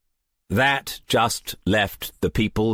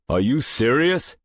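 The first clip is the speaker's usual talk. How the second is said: demanding; 6 semitones higher than usual. A narrator's reading. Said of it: "Haken demanded".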